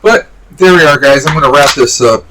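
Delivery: holding back hiccups; talking through hiccups; suppressing hiccups. Real, not acted. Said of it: "Sorry, hiccups".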